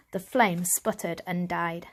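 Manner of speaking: plain reading voice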